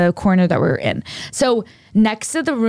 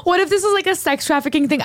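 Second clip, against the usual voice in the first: higher, anxious retold panic; panicked worry; 8 semitones higher than usual. Telling Abby her fearful thought in the hotel, higher panic. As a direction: replay the midnight fear, pitched up. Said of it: "freaking out".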